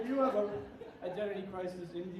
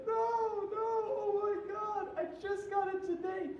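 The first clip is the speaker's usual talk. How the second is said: high, slow wailing whimper; drunk crying ex; 14 semitones higher, slower than usual. Retold or imitated. Mimicking the drunk ex over her shattered bong, high wailing whimper. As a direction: whine it like a heartbroken drunk. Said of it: "she's like".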